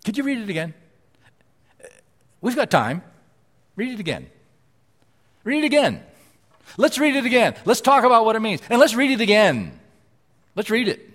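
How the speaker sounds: pleading, building to a loud high urging; imagined believer begging Paul's letter be reread; eager congregation member. Retold or imitated. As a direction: beg to hear the letter read again, rising to insistent. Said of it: "I think they'd be asking".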